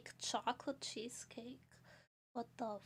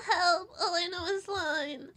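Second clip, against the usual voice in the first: mock cry; 4 semitones higher, 6 dB louder than usual.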